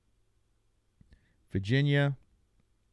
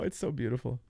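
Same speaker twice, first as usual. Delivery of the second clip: usual speaking voice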